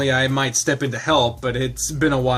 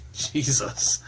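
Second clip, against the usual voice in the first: whisper; 4 dB quieter than usual. Real, not acted. Reaction whisper.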